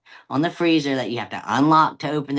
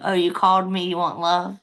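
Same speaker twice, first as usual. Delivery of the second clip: high, eager cat voice; affectionate cat; 6 semitones higher than usual. Acted. Cat answering owner who called him, high and affectionate. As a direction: pitch it up as a love-seeking cat.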